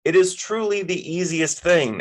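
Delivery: high, mildly shouted retort; shouting back. A narrator's reading. Friend answers from the couch, shouting back. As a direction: call it back across the room. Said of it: "Em shouted back from the couch".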